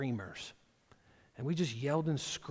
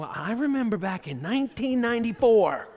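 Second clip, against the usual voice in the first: accusing spouse; 8 semitones higher, 10 dB louder than usual. Retold or imitated. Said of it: "You know".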